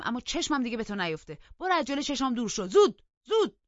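building from firm contempt to shouted order; angry rejection. A narrator's reading. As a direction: spit out each refusal, rising to a high shout. Cousin angrily rejects her, ordering away.

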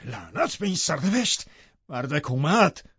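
high, frustrated curse without full volume; exasperated restrained cry; shouting. A narrator's reading. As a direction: curse bitterly, drawing out the last words. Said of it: "داد زد".

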